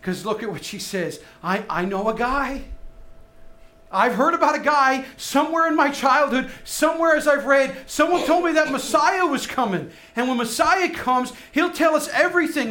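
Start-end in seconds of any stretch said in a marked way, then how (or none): 1.4-12.7 higher, louder, rising to a near shout; desperate hope. Retold or imitated. Woman at the well telling Jesus of the Messiah, desperate hope. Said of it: "she's got that desperation and that hope".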